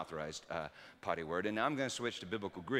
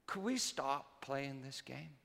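put-on tired little girl; 3 semitones higher, slower than usual. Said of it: "she said".

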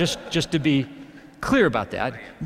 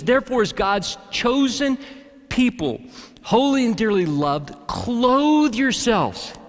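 solemn, slowed verse reading; scripture reading; 6 semitones higher, 3 dB louder, slower than usual. Acted. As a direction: read the verse with weight. Reads scripture verse aloud.

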